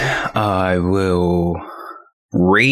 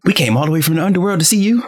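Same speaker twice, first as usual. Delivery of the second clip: high ghostly ancestors; 10 semitones higher, faster than usual. Retold or imitated. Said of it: "voicing the ancestor spirits".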